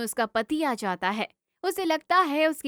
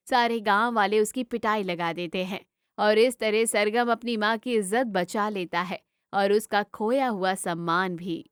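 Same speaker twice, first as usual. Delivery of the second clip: plain reading voice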